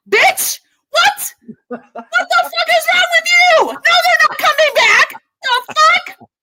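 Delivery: high, loud furious yelling; outraged shout. Real, not acted. Yelling at the anchor on TV, loud furious outrage. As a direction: scream at the screen in disbelief.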